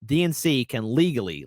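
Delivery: usual speaking voice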